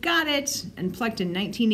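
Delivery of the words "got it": a much higher cry of victory; triumphant shout. A narrator's reading. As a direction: shout it as you grab the prize. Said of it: "just as Kyle shouted".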